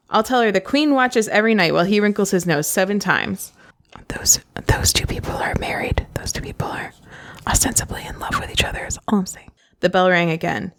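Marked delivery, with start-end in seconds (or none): 0.1-9.5 hushed conspiratorial whisper. Real, not acted. Reader confiding to her listeners about the romance, whispering. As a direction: whisper it like a secret.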